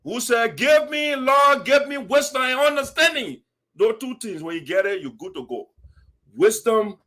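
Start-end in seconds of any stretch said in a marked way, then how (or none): 0.6-3.4 higher, pleading prayer voice; Solomon praying. Retold or imitated. Solomon praying to the Lord for wisdom, pleading. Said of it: "character in the Bible called Solomon, who said".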